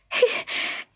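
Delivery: a short happy fairy giggle; light giggle. A narrator's reading. Larkspur giggles after learning to smile, light giggle.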